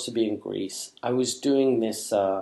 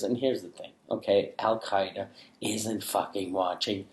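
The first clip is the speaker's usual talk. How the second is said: ordinary delivery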